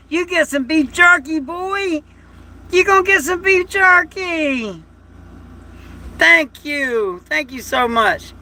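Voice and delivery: high, sing-song promise to the dog; pet talk. Real, not acted. Owner telling his dog about beef jerky, high coo.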